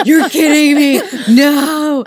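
high, loud comic exasperation through laughter; hammed-up wail of disbelief; mock outraged disbelief. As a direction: cry out as if cheated, laughing. Retells reaction to power dying again.

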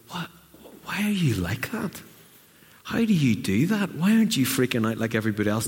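mock bewildered friend; puzzled amazement. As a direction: voice the curious onlooker. People react to your calm.

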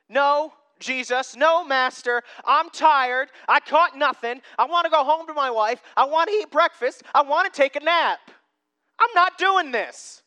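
loud, high, mounting complaint; defiant tired protest. Acted. Tired Peter refusing Jesus, wanting home and bed, loudly fed up. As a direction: pile up grievances to a final refusal.

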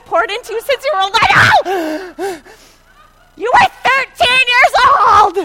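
high, loud scolding that builds to a yell; hammed-up motherly outburst; exasperated mom. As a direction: rant at the kids, stretching the last words. Mother acts out scolding her kids.

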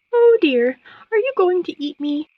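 high, fretful little voice; worried moth. A narrator's reading. Moth afraid the frog will eat her, worried. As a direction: squeak it anxiously, pitched high.

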